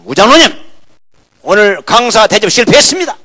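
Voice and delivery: calling out loudly to the elder. Retold or imitated. Pastor shouting jokingly at the elder.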